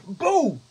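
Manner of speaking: sudden loud shout of boo; startling boo. Pretend. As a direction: jump out and yell boo. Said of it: "Did I scare you?".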